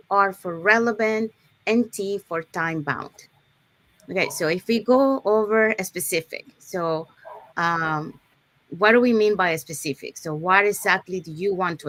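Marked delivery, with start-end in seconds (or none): none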